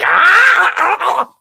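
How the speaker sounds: high-pitched comic "ah" noises; stifled reaction cry. Retold or imitated. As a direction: yelp as if biting your tongue. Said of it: "almost bit my own tongue off".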